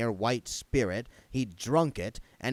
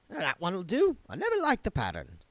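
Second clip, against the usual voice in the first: put-on higher, resigned old woman's voice; grudging housewife; 8 semitones higher than usual. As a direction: give in with a sigh.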